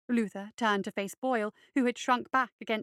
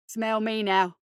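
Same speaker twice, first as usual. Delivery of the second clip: put-on a loud, cocky street kid; loud brash dare; defiant boy; 5 dB louder, slower than usual. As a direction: challenge the monster boldly. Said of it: "he said".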